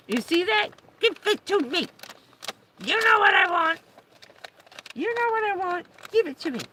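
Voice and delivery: high, loud shouting demands; demanding dog voice. Pretend. Owner voicing dog shouting for cookie, high and loud. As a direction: yell like a bossy dog wanting its treat.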